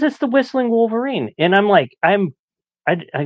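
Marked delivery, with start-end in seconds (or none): 0.0-1.3 high-pitched imitation of Dad explaining; insistent explanation. Dad answers his puzzled son, high-pitched and insistent. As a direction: pitch it well up, as Dad stating the obvious.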